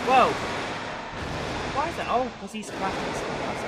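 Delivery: high, loud startled question; puzzled and surprised, up high and loud; sudden loud surprise. Real, not acted. Surprise at game event.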